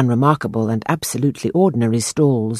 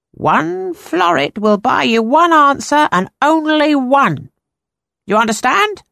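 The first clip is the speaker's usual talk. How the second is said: put-on slow, higher eerie old seer; drawn-out mysterious fortune-teller; 11 semitones higher, 4 dB louder than usual. A narrator's reading. Fortune-teller voiced in story.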